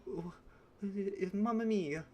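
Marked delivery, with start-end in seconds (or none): 1.3-2.0 mock Italian.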